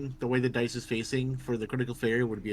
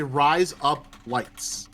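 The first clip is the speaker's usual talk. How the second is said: razor blades run together as Australian "Rise up lights", slow; Australian accent; 5 dB louder, much slower than usual. Shown as a demonstration. Teaching friends to say razor blades in Australian accent. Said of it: "If you wanna say razor blades in an Australian accent, you say".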